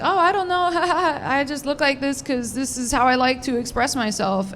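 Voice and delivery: high, mock-innocent voice; fake sweet reply. Comic to a staring kid, in a fake sweet voice. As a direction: play innocent with a sugary lilt.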